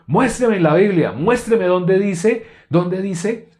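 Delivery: loud, high, slow furious challenge; imitating an angry man's shout; angry demand. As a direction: bark the demand, stretching each word. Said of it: "Así me dijo él".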